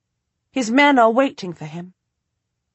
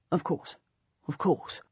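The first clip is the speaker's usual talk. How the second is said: distracted murmur; 4 semitones lower, 10 dB quieter than usual. A narrator's reading. Duke absently agreeing, distracted.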